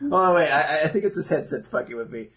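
ordinary talk, nothing marked

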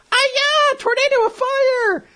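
high shrill anime battle cry; Shampoo battle cry. Retold or imitated. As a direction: shout it like an anime heroine. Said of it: "to quote the lovely Shampoo".

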